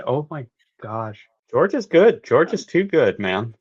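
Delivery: ordinary talk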